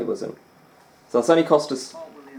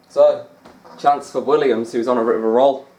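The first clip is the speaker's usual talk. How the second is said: louder mock snooker commentary; commentator voice; 6 dB louder than usual. Gaming with a friend, commentating the snooker shot in a louder voice.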